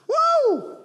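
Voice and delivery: high whooping cheer, tossed off; mock whoop. Pastor mocking Westboro with a high whoop.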